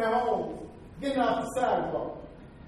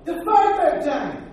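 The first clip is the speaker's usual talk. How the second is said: David's boast; 6 semitones higher, 6 dB louder, slower than usual. Retold or imitated. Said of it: "He said".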